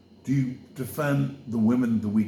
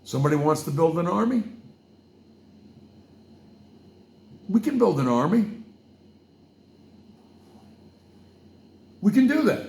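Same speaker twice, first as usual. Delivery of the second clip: building excitement; 8 semitones higher, 6 dB louder, faster than usual. Real, not acted. Pitching a militia, building excitement.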